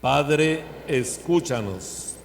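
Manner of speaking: loud call, slow drawn-out ending; loud liturgical response. Acted. Congregation response to prayer.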